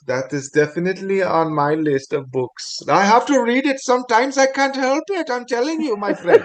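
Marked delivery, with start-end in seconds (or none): 2.9-6.4 high, playful Indian accent impression. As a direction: ham up an Indian shopkeeper accent. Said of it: "That's one accent I can't get right is the, the Indians".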